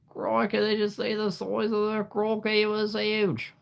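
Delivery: put-on a second, bigger try at the Aussie croc-hunter Australian accent; Steve Irwin Australian accent. Streamer retrying the Crocodile Hunter for chat, in an over-the-top Australian accent. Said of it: "not perfect, but it's as good as you're gonna get".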